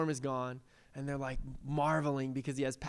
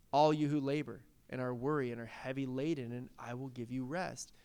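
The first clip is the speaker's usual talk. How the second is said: soft, lowered, soothing voice; gentle invitation; 5 semitones lower, 6 dB quieter than usual. Acted. Preacher quoting Jesus to the weary, gentle and soft. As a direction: quietly offer rest to the burdened.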